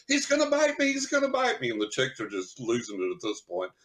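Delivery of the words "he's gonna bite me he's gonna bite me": squeaky high panic; panicked. Retold or imitated. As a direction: yelp it in mock terror. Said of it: "I'm like".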